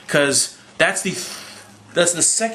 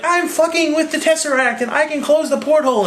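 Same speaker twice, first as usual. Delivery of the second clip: mock female voice; 13 semitones higher than usual. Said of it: "Scarlett Johansson to be like".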